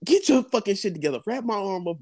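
put-on telling the girlfriend to wrap his bleeding arm; urgent commands. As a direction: snap her out of it. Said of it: "I'm like".

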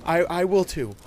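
put-on high, loud girl, stammering then firm; determined child's blurted declaration; declaring. A narrator's reading. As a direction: insist on being included. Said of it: "Amelia declared".

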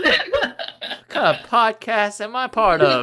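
high, slow mock outrage; playfully appalled; mock disbelief. Real, not acted. Reacting to a crude story.

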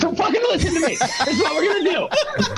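High-pitched mock bossy shout; mock leader shout. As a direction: Yell orders like a bossy leader, laughing.

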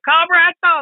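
high loud call from behind; called out name. Retold or imitated. A rider behind him calling his name, loud mock call. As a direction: shout the name from fifteen feet back.

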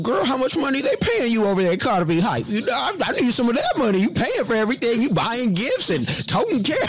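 high, fast teasing voice of Pierre; Pierre impression. Co-host teasing her about her pay, in high fast voice. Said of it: "I'm gonna do my Pierre impression".

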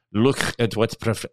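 put-on halting, failed German accent. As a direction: slowly attempt a bad German accent. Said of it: "I can't even do a German accent".

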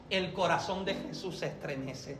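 usual speaking voice

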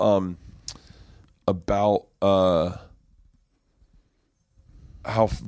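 normal talking voice

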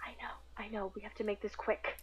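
hushed, secretive reply; under the breath, pressed for time; urgent whisper. Acted. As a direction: whisper so the next room can't hear. Secret talk with man hiding.

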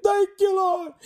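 high, loud thankful shout; high loud gleeful thank you Lord; joyful praise. Retold or imitated. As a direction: cry out thanks in joy. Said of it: "I really said".

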